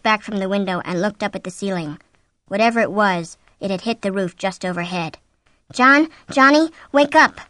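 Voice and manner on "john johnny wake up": loud, raised call to a sleeper; urgent waking call. A narrator's reading. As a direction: call out to rouse him. Wife urgently wakes sleeping husband.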